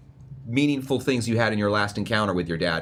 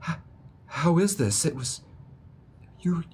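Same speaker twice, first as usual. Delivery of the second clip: high, halting, lightly choked-up voice; tearful disbelief; 7 semitones higher than usual. Acted. Crying father reunited with lost children, halting high voice. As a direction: falter in disbelief, pausing between fragments. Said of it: "he just starts crying".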